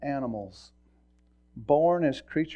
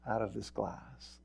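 ordinary talk

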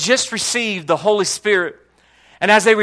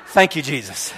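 laughing low thank-you, relieved; 4 semitones lower than usual. Retold or imitated. Chaplain thanking Jesus after being told to stay, laughing. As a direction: chuckle your thanks. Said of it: "I'm like".

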